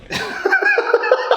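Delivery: a high, bursting real laugh. Real, not acted. To the host, laughing at his own story, high burst of laughter.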